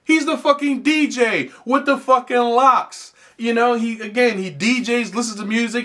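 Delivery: usual speaking voice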